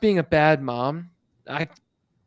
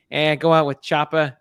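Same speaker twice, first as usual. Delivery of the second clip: put-on breezy Aussie accent, tossed off; a careless mum, in a light Australian accent; mock Aussie accent; 4 dB louder than usual. Host mocking Hera's parenting in a jokey Australian accent. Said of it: "I don't know why I dove into that accent".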